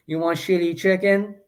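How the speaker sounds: cartoonish mock Chinese accent, slowing at the end. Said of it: "From Shitty Wok?".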